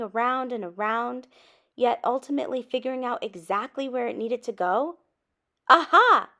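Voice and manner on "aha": loud, high burst of discovery; excited exclamation. A narrator's reading. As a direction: shout it as the idea hits. Sudden idea.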